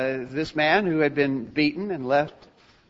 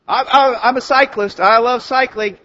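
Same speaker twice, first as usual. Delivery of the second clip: high, loud protest of devotion; hammed-up cycling fanatic; insistent enthusiast; 7 semitones higher, 7 dB louder than usual. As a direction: insist eagerly against all evidence. Insists despite having no bicycle.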